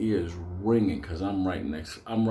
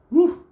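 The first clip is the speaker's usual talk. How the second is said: mock whoop; 10 dB louder than usual. Seeing Marilyn, excited cry.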